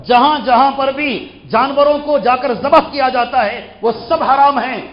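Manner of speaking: loud, high-pitched sermon delivery; forceful preaching. Real, not acted. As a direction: drive each phrase home to the congregation. Preacher rises to full force.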